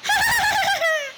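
high, hearty cynical cackle; tiny lizard laughing from the belly; lizard's full laugh. A narrator's reading. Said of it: "lachte aus vollem Halse".

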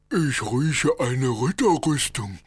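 slow, high booming dragon. Acted. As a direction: sniff out the knight as a dragon. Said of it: "sagte der Drache".

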